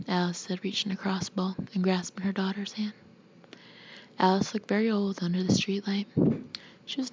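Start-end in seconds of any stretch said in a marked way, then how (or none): none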